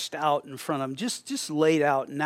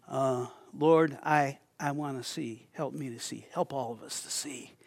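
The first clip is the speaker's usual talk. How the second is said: soft, hushed pleading prayer; quiet prayer; 3 semitones lower, 6 dB quieter than usual. Real, not acted. Pastor praying for sight before the congregation, hushed. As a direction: ask God humbly under the breath. Prays to God.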